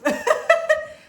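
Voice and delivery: high giggle. Real, not acted. Laughs after book changed life.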